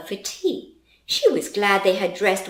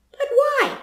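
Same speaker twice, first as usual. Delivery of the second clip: grandma voice; 5 dB louder than usual. Acted. Grandmother asks why.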